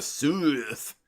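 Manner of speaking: south drawn out as "sooth", Southern attempt; botched Southern south, with an "oo" vowel; attempted Southern accent. Shown as a demonstration. Host groping for a Southern accent, south as long "sooth". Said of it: "I don't know what the accent is to say south".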